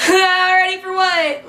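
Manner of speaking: high, drawn-out alarmed question; retold rising panic, stretched slowly; starting to freak out. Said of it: "starting to freak out".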